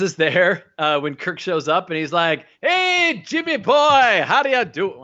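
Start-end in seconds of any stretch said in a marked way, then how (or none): 2.6-5.0 hammed-up Bones accent. Host voices Bones greeting Kirk, hammed-up put-on accent. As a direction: ham up the doctor's greeting to Kirk. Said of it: "can do none of the accents".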